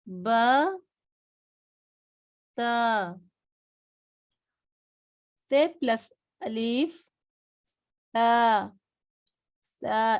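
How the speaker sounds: با and تا said slowly as Arabic letters; practice drill. Shown as a demonstration. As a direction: model each letter for repetition. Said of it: "میرے ساتھ practice کریے پھر سے". (تا نرمی سے پڑھیں)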